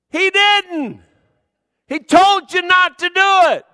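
loud, high preacher's shout, building to a peak; full-force preaching. Acted. Preacher warning the congregation who ignored God, in a loud, high shout. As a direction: hammer it home to the congregation at full volume.

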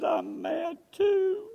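high, slow, crying words; voice cracking into tears. Retold or imitated. Widow confessing anger to her pastor, voice breaking in tears. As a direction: let the voice break as she admits it. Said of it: "her face started cracking".

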